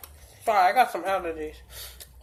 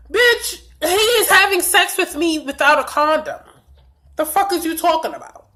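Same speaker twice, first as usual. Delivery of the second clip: indignant outburst; 6 semitones higher than usual. Retold or imitated. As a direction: jump up and scold her. Venting at the wife's question.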